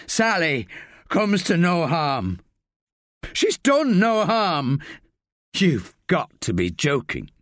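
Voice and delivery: rising, heated defiance; defiant captive. A narrator's reading. Rick defying Staff to protect Sally, heated. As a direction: refuse him, voice climbing.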